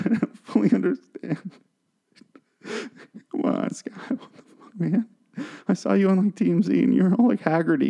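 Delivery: high, breaking voice, dropping out to breath in places; distraught. Acted. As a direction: plead like a heartbroken fan falling apart. Breaking down over fallen hero Scott.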